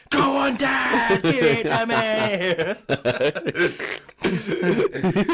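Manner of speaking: high, loud hammed-up cheer; mock cheering shout. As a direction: yell it like a lewd fan. Acted silly cheering in story.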